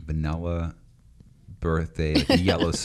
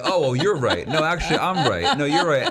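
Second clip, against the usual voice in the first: lightly higher, playful flip-flopping; teasing, going back and forth; mock back-and-forth; 8 semitones higher, 3 dB louder than usual.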